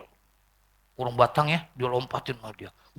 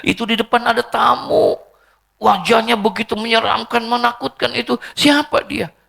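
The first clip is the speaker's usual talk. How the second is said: high, urgent alarmed report; startled, alarmed; 10 semitones higher, 4 dB louder than usual. Retold or imitated. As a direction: voice a frightened daughter telling her father of a stranger. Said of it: "Siti Fatimah kaget". (kaget dan cemas, nada tinggi)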